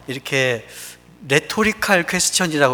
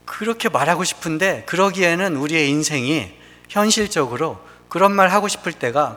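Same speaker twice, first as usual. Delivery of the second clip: normal talking voice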